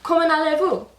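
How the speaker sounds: Hasavat in French. Acted. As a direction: ask it again with mock politeness. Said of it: "So he says".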